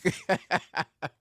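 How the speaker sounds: loud, high burst of laughter; real laugh. Real, not acted. Amused by weapons at work, loud laughing. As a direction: crack up at your own story. Cracks up at his own story.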